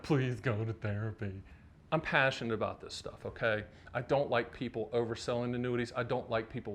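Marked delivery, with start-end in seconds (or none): 0.0-1.3 softer, lower imitated pleading; lightly voiced producer, low and quiet; mock producer plea.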